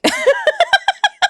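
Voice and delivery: high, wordless wheezing laugh; frustrated laughter. Real, not acted. Streamer at peak frustration, helpless high laugh.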